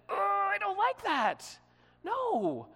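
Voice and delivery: high-pitched, drawn-out exasperated refusal; mock angry protest. Bible reader objecting to God's word, mock angry protest. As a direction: whine in acted indignation at the answer. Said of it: "And we get angry".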